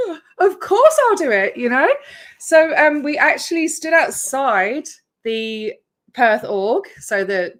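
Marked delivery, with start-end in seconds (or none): 0.1-1.6 high, louder mock-eager agreement; mock eager yes. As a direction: play up a keen instant yes. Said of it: "And so I was like".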